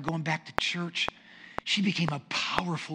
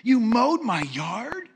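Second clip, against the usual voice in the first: slow, raised, louder incredulous question; mock astonishment; 6 semitones higher, 6 dB louder, much slower than usual. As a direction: imitate a neighbor stunned by the favor. Neighbor discovers his mowed yard.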